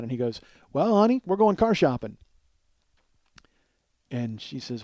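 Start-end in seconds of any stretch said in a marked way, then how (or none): 0.7-2.2 higher, louder retold announcement; mock-breezy announcement. Dan tells mom about the wrecked car, higher and breezy. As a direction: sing out the bad news as a punchline.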